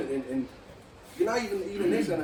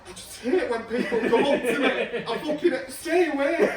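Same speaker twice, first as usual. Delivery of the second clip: higher, laughing outburst; hammed-up fed-up yelp; mock rant; 5 semitones higher, 4 dB louder than usual. Joking about fans who come up to him, mock rant.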